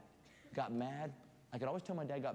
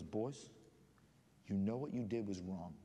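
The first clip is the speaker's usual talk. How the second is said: low, lightly voiced stern father; a hint of dad before the belt; 8 semitones lower, 4 dB quieter than usual. Dad facing the boys with his belt, lightly voiced stern father. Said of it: "and he goes".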